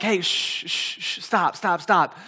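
put-on mom hushing a chatty child; quick repeated shushes, then a curt stop; shushing. Mom shushing her chatty son.

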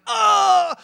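high, loud, drawn-out "ah"; mock pained yell. Dad struck by son's toy in the kitchen, mock pained yell. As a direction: cry out as if hit in play. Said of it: "it shoots this red thing out and hits me".

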